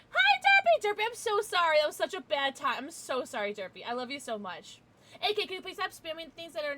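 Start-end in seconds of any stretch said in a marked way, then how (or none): 0.1-1.8 very high, loud gush, easing slightly; effusive squealed greeting. Real, not acted. Streamer greeting Derpy with apologies, high loud gushing.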